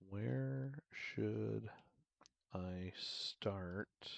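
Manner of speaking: slow, drawn-out words with long pauses; slow dictation. Real, not acted. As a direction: speak slowly as if typing each word out. Dictating a typed question slowly.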